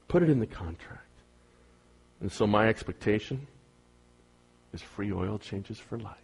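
low, soft, confiding anecdote; softly told sales story; quiet storytelling aside. Acted. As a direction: lean in and tell it low and easy.

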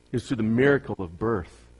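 usual speaking voice

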